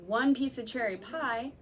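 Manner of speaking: normal talking voice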